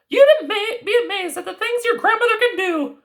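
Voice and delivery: high fairy-tale granny voice; grandmother voice. Acted. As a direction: play the knowing old grandmother. Grandmother character voice.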